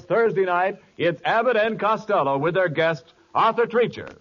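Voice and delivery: ordinary talk, nothing marked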